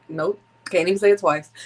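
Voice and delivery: ordinary talk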